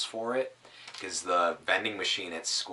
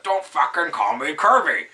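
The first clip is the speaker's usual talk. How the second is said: higher, loud mock outburst; hammed-up angry friend; imitating angry friend; 9 semitones higher, 13 dB louder than usual. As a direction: mimic him snapping at the nickname. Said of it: "he'd be like".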